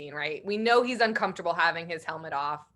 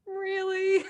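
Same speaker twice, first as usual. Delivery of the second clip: high-pitched incredulous question; mock disbelief; 12 semitones higher than usual. Viewer reacts to armor knocked out by elbows, high mock disbelief. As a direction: squeak it out in doubt, far above usual pitch.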